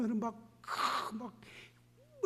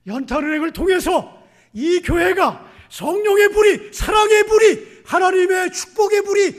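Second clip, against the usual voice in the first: fiery preaching; 11 semitones higher, 16 dB louder than usual. Real, not acted. Preaching at full force.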